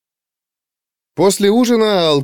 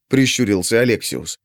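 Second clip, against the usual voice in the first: plain reading voice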